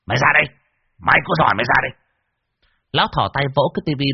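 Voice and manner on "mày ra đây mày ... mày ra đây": loud, higher taunting yell at the ghost; furious challenge. Acted. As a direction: dare the ghost to come out, shouting. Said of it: "phẫn nộ chửi". (quát thách thức con ma, to và cao giọng)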